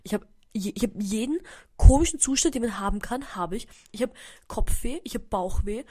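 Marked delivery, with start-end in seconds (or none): none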